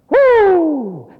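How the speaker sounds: long, high "whoo" of being stung; loud high whoop. Acted. Preacher stung by God's rebuke, loud high whoop. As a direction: let out a loud pulpit whoop. Reacts to a convicting word.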